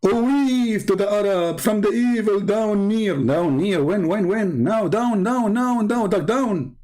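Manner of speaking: high, slow panicked wailing; hammed-up fear, pitched high; mock scared wail. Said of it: "Muhammad is scared".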